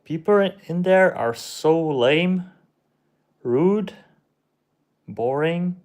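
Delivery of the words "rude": ordinary talk, nothing marked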